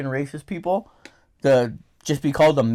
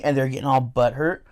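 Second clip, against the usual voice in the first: ordinary talk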